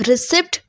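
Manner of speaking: Receipt as ri-SEPT, p sounded; mispronunciation. Shown as a demonstration. Teacher warning students off the error, mispronounced. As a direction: say Receipt to rhyme with accept. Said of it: "is not the correct pronunciation".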